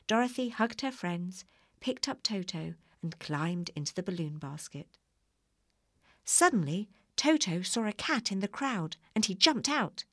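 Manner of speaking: ordinary delivery, nothing marked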